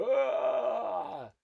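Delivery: long high scream of a falling gnome; loud yell tumbling from a branch. Acted. Gnome falls from the tree.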